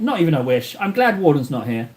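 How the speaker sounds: ordinary delivery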